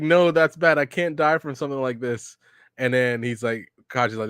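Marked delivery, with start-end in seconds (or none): 0.0-2.4 higher-pitched, hammed-up protest; mock panic. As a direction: imitate a panicking hero, pitch jumping up. Naruto panicking in retold scene.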